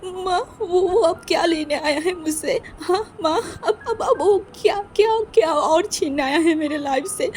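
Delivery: high, breaking voice through tears; sobbing son. Acted. Son crying to his mother, pleading and terrified. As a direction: stammer through frightened sobs.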